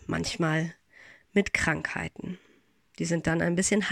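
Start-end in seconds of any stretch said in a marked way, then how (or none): none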